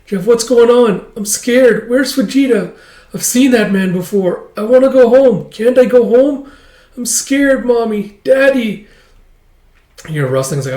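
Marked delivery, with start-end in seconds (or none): 0.1-8.9 put-on high-pitched scared little-kid voice; frightened child. Kidnapped girl wanting her parents, frightened. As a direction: plead in a high, panicky child's voice.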